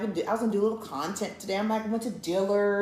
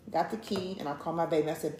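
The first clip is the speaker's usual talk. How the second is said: ordinary talk, nothing marked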